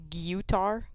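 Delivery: "guitar" as gyoo-tar; odd pronunciation. Shown as a demonstration. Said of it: "if they pronounced it like".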